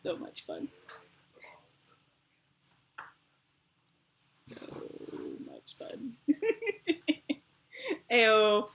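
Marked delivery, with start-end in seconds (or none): 0.0-6.1 unvoiced whisper. Real, not acted. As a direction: breathe it with no voice.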